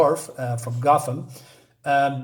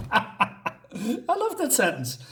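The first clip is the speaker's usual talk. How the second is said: laughing; 7 semitones higher, 3 dB quieter, much slower than usual. Real, not acted. Laughing over a line just read.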